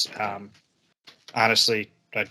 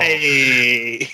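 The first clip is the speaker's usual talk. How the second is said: fake Italian accent; 4 dB louder than usual. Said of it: "obnoxiously fake Italian".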